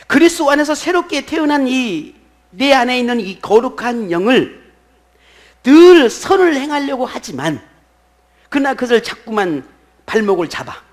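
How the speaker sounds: high, loud, ringing sermon delivery; full-force preaching. Real, not acted. Pastor proclaiming God awakened the conscience, full-force preaching. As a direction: drive each phrase home to the congregation.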